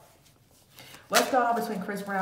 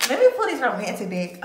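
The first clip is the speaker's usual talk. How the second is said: louder, eager announcement; excited; 3 semitones higher, 7 dB louder, faster than usual. Real, not acted. Tarot reader excited to pull the romantic deck, louder. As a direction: get pumped to pull the cards. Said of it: "I just got a little bit excited".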